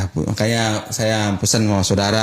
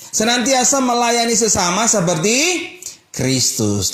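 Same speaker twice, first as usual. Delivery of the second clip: full-force preaching; 11 semitones higher than usual. Acted. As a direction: call the congregation to repeat after you. Congregation recites theme.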